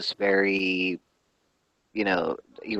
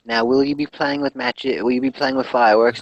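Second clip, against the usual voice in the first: lightly voiced interviewer, higher pitch; 4 semitones higher, 6 dB louder than usual. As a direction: imitate a woman interviewer putting a question. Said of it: "the woman's like".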